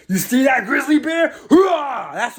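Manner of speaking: high excited rally cry; hyped shout. Acted. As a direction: pump up the crowd, loud and high. Hyping up as grizzly bears.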